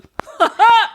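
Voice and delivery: single loud, high burst of fake laughter; one startled, not-quite-real "ha"; surprised laugh. Husband caught off guard by ridiculous remark, surprised laugh. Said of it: "laugh in surprise? Like, it's not a real laugh".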